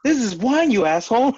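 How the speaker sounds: loud, high-pitched indignant outburst; mock outrage. Joking drinker complains about the blood, mock outraged. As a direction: pretend to be outraged for a laugh.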